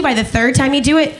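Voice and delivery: ordinary talk, nothing marked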